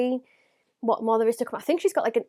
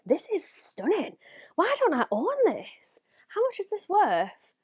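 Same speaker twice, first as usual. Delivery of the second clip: high, slow, amazed gushing; excited awe; 8 semitones higher than usual. Real, not acted. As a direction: swoon over it, pitched up. Gushing over palette.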